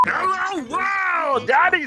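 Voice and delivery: slurred, high frog puppet voice; drunk Kermit voice. Acted. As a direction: sound like a tipsy Kermit. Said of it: "Why do you sound like a drunk Kermit?".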